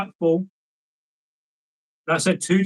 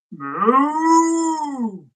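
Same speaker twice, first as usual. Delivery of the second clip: long moo, pitched higher; a drawn-out sung moo; cow's moo; 7 semitones higher than usual. A narrator's reading. The cow answering the lad with a high moo. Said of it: "said the cow".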